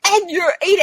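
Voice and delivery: high, tearful comic whine; mock breakdown. Patient losing it on the family at dinner, mock tearful. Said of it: "I lost it on".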